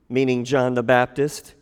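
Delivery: ordinary talk, nothing marked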